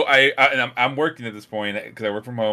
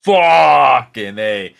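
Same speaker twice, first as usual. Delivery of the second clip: drawn-out loud "Fucking A"; slow exasperated shout; 8 dB louder, much slower than usual. Retold or imitated. Finding water on the floor, slow exasperated shout. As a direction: let it out long and loud.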